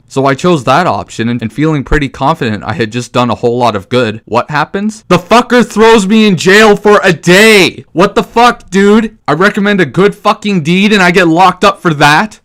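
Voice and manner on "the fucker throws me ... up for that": loud, much higher, indignant shouting; outraged yell. Real, not acted. Gamer ranting at the game's jail, loud outraged shouting. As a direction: yell it like you can't believe the injustice.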